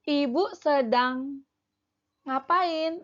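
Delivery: slow, rising question tune; rising pitch on ngapain; question intonation. Shown as a demonstration. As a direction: ask it with a clear rise at the end.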